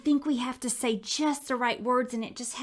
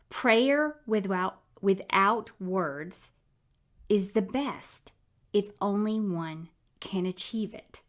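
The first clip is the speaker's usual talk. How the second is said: slow reading. Real, not acted. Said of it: "I'm gonna repeat that".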